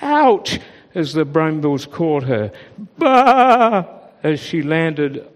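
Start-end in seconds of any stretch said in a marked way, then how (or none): 0.0-0.6 pained yelp. A narrator's reading. Caught in brambles. 3.0-3.8 startled bleat. A narrator's reading. Landing on a ledge.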